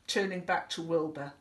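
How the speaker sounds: ordinary delivery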